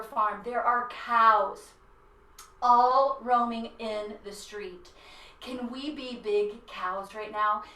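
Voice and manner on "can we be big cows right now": normal talking voice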